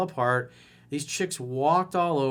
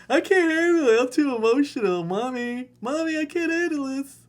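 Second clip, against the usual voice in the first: put-on high, loud whining baby voice; mock whiny crybaby; 11 semitones higher, 4 dB louder than usual. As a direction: whine like a scared little kid calling mommy. Mocking whiny guy to girlfriend.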